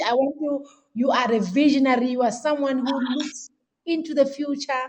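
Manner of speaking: normal talking voice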